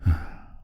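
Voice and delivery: breathy sigh after a memory; wistful sigh. Acted. Finishes a sad childhood memory.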